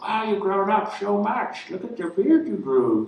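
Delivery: lightly voiced grandma, high and slow; a hint of a doting grandma marvelling; mild impression of an aunt gushing over a grown grandson. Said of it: "your grandma's like".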